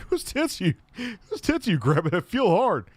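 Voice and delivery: high put-on voice; quoted video line. Fan retelling a video gag, high mock voice. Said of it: "He's like".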